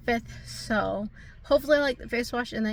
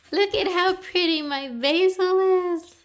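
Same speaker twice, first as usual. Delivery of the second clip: high, loud, drawn-out coo; stretched-out, high-pitched admiration; delighted gushing; 9 semitones higher, 5 dB louder, slower than usual. Real, not acted. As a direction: gush slowly over something pretty. Showing off the basil, delighted.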